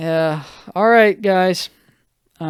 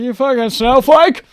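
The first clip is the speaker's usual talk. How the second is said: high, loud mock jeer; exaggerated pretend insult, shouted high; mock scornful yell; 9 semitones higher, 10 dB louder than usual. Dad mocking his crying son, hammed-up scornful yell. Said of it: "He wasn't like that".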